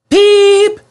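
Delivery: very high, loud whistle blast; guard whistling for departure; guard's whistle. A narrator's reading. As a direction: blow it like a whistle. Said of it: "whistled the guard".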